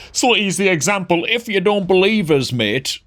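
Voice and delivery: cheeky northern chav slang; northern chav accent. Pretend. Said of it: "I turned into a northern chav".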